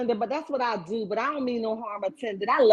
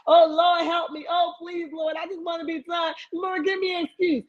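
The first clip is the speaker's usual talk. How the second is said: high, pleading cry to the Lord, half shouted; desperate prayer; 8 semitones higher than usual. Retold or imitated. Mocking a woman begging the Lord for help, high pleading. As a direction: beg God for a way out, voice up high. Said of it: "You be like".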